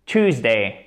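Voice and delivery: American Tuesday, said as TOOZ-day; plain T and OO in American Tuesday; American English pronunciation. Shown as a demonstration. Teacher contrasting accents, American English Tuesday as TOOZ-day. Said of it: "et en American English".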